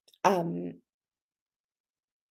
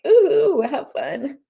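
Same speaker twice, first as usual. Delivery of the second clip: teary mock cheer; 5 semitones higher, 4 dB louder, much slower than usual. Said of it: "It's like".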